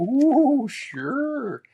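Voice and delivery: high, loud ooh sure; Mo impression. Teller imitating Mo to the host, high and loud. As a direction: channel Mo's glee. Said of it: "starting to think like a Mo".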